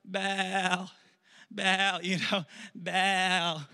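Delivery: mock pleading moan; drawn-out acted calling. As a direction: stretch the name out like a weary chant. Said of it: "so now they're like".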